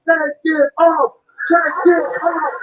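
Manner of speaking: drawn-out, slow teasing guard; slow mock coaxing. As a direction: stretch each word out slowly. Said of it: "they were like".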